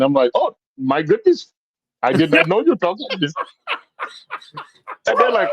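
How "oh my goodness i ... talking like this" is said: higher-pitched, delighted mock Indian accent; imitated Indian accent. Storyteller greeting the shopkeepers in their own mock Indian accent. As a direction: put on an eager, hammed-up Indian accent. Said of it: "so I started talking".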